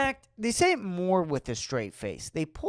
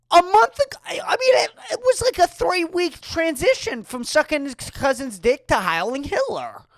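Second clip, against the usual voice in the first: much higher, strained, nearly cracking; high-pitched outburst; 11 semitones higher, 5 dB louder than usual. Acted. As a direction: push up into a squeaky outraged peak. Comic's rant peaks.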